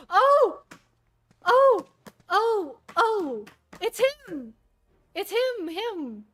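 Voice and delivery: gasping high loud oh's; so excited. Real, not acted. Fan spotting a favourite character, high and loud. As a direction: squeal at the reveal. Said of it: "I'm so excited".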